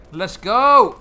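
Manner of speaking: loud, high rallying cry; excited shout. Real, not acted. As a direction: pump yourself up and yell. Rallying in game.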